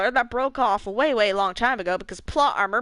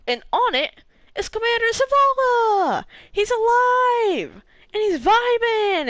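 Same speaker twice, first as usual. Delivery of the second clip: much higher, drawn-out gleeful announcement; excited; 12 semitones higher, 4 dB louder, slower than usual. Real, not acted. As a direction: gush it out high and slow with delight. Excited news Zavala is alive.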